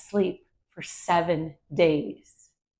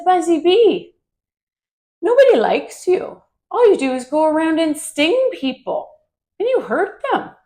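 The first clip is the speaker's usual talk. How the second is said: high, louder scolding frog voice; mean frog; 8 semitones higher, 5 dB louder than usual. Acted. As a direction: tell the bee off in a high, snippy voice. Frog scolds Buzzy Bee.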